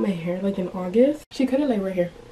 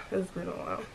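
quiet repeat; 3 semitones lower, 10 dB quieter than usual. Real, not acted. Repeats the phrase to herself.

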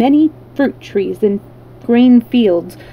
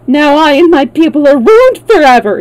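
high, loud tearful wail; acted despairing sob, pitched up; sobbing lament; 5 semitones higher, 7 dB louder than usual. A narrator's reading. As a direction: wail it as if all is lost. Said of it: "he sobbed".

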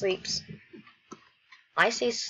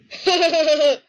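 high, loud burst of laughter; loud giggling fit; laughing; 7 semitones higher, 9 dB louder than usual. Real, not acted. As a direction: crack up loudly. Laughing at the scene.

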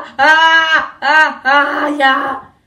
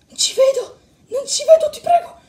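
high-pitched frightened plea; panicked; 11 semitones higher than usual. Acted. Sunshine cannot see and begs, high panicked voice. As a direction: plead in rising panic.

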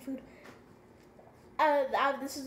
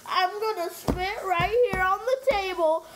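loud; 3 semitones higher, 7 dB louder than usual. Real, not acted. Mouth burning from spice.

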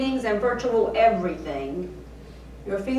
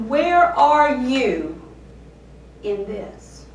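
louder and slow, then dropping away; fierce slow question; 4 semitones higher, 8 dB louder, slower than usual. Acted. Preacher demanding of God where he is, angry and slow. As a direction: drag the accusing question out. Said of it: "with the fierce, angry tone".

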